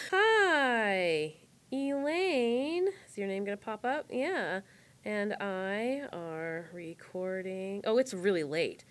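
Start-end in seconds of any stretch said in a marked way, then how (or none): 0.1-2.9 drawn-out words, spoken to oneself; dictating while typing. Real, not acted. Typing a post, saying it aloud. 5.0-7.8 very slow, soft, word by word; dictating while typing. Real, not acted. Typing a post, saying it aloud.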